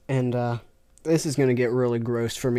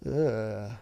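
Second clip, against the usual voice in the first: ordinary talk, nothing marked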